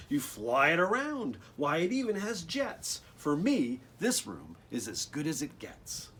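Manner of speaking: lively, sing-song storybook read; bouncy picture-book reading voice; reading to children. Acted. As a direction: bring the rhymes alive for the kids. Reads the rhymed book text.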